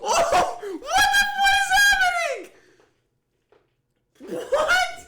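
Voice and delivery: very high, loud laughing shrieks; shrieking disbelief. Real, not acted. Streamer stunned by a surprise sleep vote, laughing shriek.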